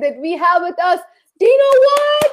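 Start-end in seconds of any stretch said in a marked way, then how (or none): 1.4-2.3 high, loud announcer-style name call; cheering at a game. Real, not acted. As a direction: announce the name like a crowd roar. Host announces guest.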